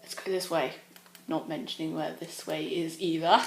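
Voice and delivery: hushed, scared hiding talk; quiet hiding voice. Real, not acted. Hiding from the killer.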